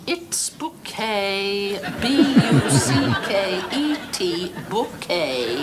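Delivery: slow, deliberate bouquet with stress on KAY; spelling then correcting. Retold or imitated. Hyacinth patiently correcting the postman, slowly stressing boo-KAY. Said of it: "The accent on the second syllable".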